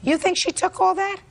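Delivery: disbelieving, pitch leaps way up; incredulous. Real, not acted. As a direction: ask it in total disbelief. Judge incredulous at claim.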